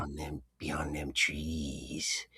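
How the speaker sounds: very slow, soft, in a low put-on voice; talking real slow. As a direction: drag each word out, quiet and wary. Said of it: "he's talking real slow".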